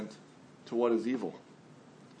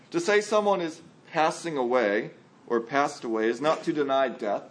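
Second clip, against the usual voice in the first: ordinary talk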